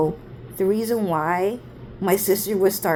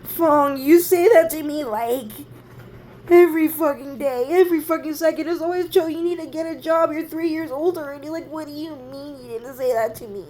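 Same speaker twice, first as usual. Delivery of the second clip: upset accusation; 8 semitones higher than usual. Retold or imitated. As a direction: hurl the reproach in a much higher voice, losing force. Re-enacting quarrel, then denies crying.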